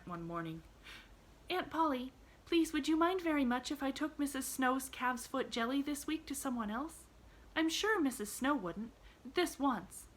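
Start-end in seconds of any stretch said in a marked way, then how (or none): 1.5-10.1 lightly voiced young girl, pitched higher; a bright little girl, gently arguing; a hint of an earnest young girl; Pollyanna. A narrator's reading.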